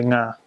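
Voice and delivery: "Nga" starting on the ng of "sing", straight into "ah"; initial NG sound. Shown as a demonstration. Teacher isolating the Cebuano letter, "Nga" as one ng sound. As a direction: open "Nga" with a nasal ng, no vowel before it. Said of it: "take off the I and the O and you just say".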